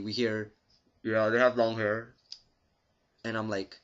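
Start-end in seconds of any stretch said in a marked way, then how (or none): 1.0-2.1 crackly police radio dispatch; like it's coming over a squad car radio, louder. Retold or imitated. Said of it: "over the static radio thingy".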